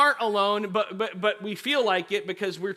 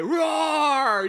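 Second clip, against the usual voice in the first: long, raised mock roar; lion roar; 7 semitones higher, 4 dB louder than usual. Twelve-year-old brother annoying his sister, playful lion roar. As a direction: roar like a kid playing lion. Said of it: "roaring like a lion".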